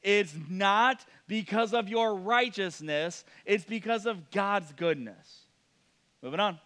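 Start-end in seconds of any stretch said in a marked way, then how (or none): 0.0-5.5 "not" stretched and each word drawn out slowly; very slow, pointed delivery; very slow emphasis. Shown as a demonstration. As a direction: drive the point home word by word. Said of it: "In case you're not getting this".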